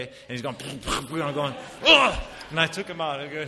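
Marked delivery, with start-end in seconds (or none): 1.9-2.2 loud mock grunt. Baby bumping head.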